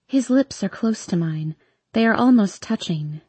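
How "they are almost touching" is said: usual speaking voice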